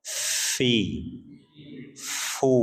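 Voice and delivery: Fi and Fu whispered on breath; airy fi fu. Shown as a demonstration. Teacher drilling the class on fa sounds, breathy syllables. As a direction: let the air hiss through Fi and Fu. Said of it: "sampai sukunnya aja ada aliran".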